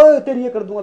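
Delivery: high, loud mock threat. Friend posturing for the camera, loud mock threat. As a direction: call it out like a show-off.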